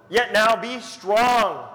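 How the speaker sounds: slow, loud, ringing proclamation; proclaimed. Acted. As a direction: declare it with full force. Preacher proclaims God's words.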